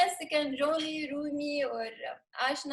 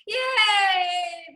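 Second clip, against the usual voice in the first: loud high cheer; cheering yay; 5 semitones higher, 7 dB louder than usual. Shown as a demonstration. Teacher leading the class cheer. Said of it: "So now in Hindi".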